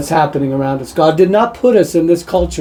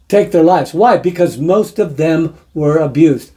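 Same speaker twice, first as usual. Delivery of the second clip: ordinary talk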